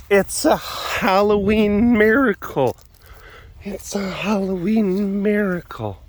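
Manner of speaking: high, drawn-out mock-dramatic cry; hammed-up slow exclamation; theatrical proclamation. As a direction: stretch it out like announcing a wonder. Theatrical celebration of animal appearing.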